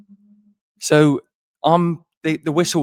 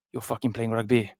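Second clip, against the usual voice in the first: bad Welsh accent; 3 dB quieter than usual. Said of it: "this is the worst Welsh accent in the world".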